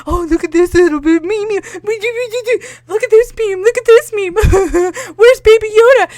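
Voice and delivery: mock annoying giggly moviegoers; hammed-up silly fan voice; mocking chatter.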